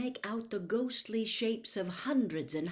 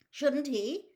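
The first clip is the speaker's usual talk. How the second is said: higher, worried question; anxious; 7 semitones higher, 3 dB louder than usual. Acted. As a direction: ask with hidden worry. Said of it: "said Mister Weasley anxiously".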